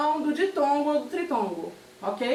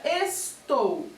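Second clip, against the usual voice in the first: "estou" slowly split into es and tou; syllable by syllable. Shown as a demonstration. Teacher introducing an example word, "estou" syllable by syllable. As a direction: space out the two syllables of "estou". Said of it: "separar esta palavra por sílaba".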